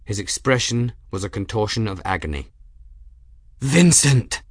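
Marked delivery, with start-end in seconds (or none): 3.6-4.4 raised, louder cry of anguish. A narrator's reading. Man finds his friend pinned under shelf, anguished cry. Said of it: "his voice anguished".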